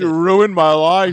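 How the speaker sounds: mock outraged yell. Mock outrage at being interrupted.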